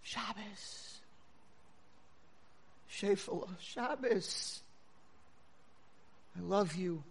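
hushed, as if into an ear; retold tender whisper. Grandmother to granddaughter at the Shabbos table, whispering. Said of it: "I whispered in my granddaughter's ear".

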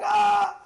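high, loud "aah" yelled back; acted high-pitched retort scream; answering scream. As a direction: imitate a wife screaming back. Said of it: "she starts saying a word back".

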